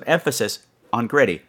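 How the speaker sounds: ordinary delivery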